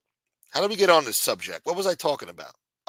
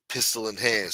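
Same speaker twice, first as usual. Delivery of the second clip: ordinary talk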